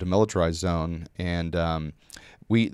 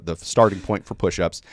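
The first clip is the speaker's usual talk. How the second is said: usual speaking voice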